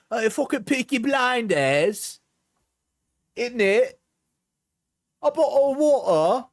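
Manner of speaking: a cartoonish Cockney bloke à la Peaky Blinders; Peaky Blinders accent. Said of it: "an accent I heard on television, like the Peaky Blinders".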